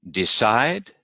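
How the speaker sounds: DECIDE as de-CIDE, rising on CIDE; list-like rise on the end of DECIDE; second-syllable stress. Shown as a demonstration. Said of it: "the second syllable is stressed".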